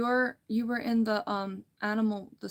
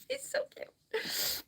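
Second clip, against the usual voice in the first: giggly gushing; 14 semitones higher, much slower than usual. Real, not acted. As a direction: melt into a drawn-out, tiny giggle-voice. Giggling over a cute puppet.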